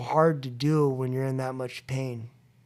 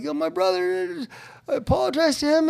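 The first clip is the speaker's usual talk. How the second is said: much higher, agitated blurt; worked-up complaint; 13 semitones higher than usual. Retold or imitated. Telling his sponsor what happened, worked up and high. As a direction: spill it out, wound up.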